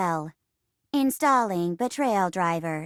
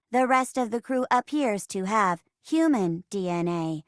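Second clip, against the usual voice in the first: ordinary talk, nothing marked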